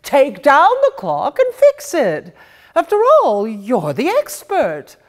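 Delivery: the wife setting her husband straight; bossy mother. Acted. Mother voiced in read story.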